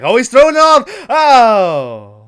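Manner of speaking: high, loud excited yell at the screen; excited shout. Retold or imitated. Watching the movie, yelling excitedly at the big reveal. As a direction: cry out as the monster reveals itself. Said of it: "I was like".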